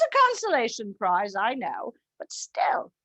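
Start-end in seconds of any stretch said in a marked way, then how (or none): 2.2-2.9 whisper. Real, not acted. Aside whisper.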